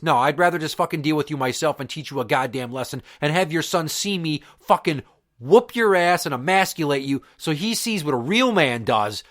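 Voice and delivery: ordinary talk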